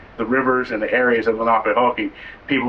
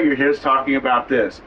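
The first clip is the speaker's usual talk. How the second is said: normal talking voice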